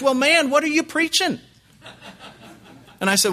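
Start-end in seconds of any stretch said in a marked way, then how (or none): 0.0-1.4 very high, loud accent impression; Steve Lawson's accent. Visiting preacher asking about Sunday on the phone, in exaggerated accent. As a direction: ask it in an exaggerated, pitched-up accent. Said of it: "with that accent".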